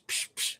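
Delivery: unvoiced psh-psh of shoes flying off; playful whooshing psh effect; breathy psh noises. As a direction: puff out two quick airy psh sounds. Mimics him kicking shoes off.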